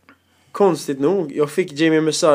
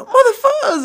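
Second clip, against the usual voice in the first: high, loud angry curse; furious yell; 4 dB louder than usual. Retold or imitated. Furious uncle curses at nephew about the bus, high shout. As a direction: scream it at the nephew. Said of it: "Så han blev skitarg".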